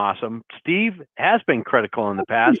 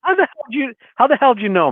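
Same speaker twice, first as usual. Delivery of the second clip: surprised exclaim; 12 semitones higher, 4 dB louder, faster than usual. Real, not acted. Caller caught out, exclaims.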